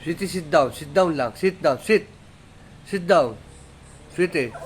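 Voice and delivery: high, loud, short orders to a pet; dog command. Real, not acted.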